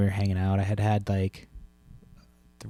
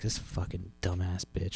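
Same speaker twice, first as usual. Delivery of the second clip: a slow, quiet mutter of contempt; muttered curse; 2 semitones lower, 11 dB quieter than usual. Retold or imitated. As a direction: curse her under your breath, slowly. Cursing the cashier to himself.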